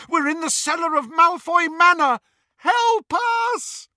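high, loud frantic yell; desperate yell. Acted. Trapped Harry yells at the mirror, desperate loud cry. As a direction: shout for help in mad desperation. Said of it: "in mad desperation".

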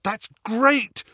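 high, loud burst of relief; relieved delight. A narrator's reading. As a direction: let relief surge out. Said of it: "relief surging through him".

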